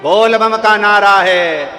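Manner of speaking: loud, long-drawn rallying chant; slogan call. Shown as a demonstration. Preacher leading devotees in "बोल बम", loud drawn-out chant. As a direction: call it out slowly to a crowd. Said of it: "जरा जम के बोलोगे".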